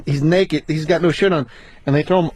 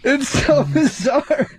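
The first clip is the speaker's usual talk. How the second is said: laughing; 7 semitones higher, much slower than usual. Real, not acted. Laughing at a funny memory.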